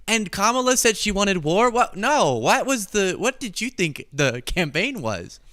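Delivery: high-pitched, exasperated disbelief; incredulous retort. Real, not acted. Host answering Trump supporters about Kamala wanting war, incredulous.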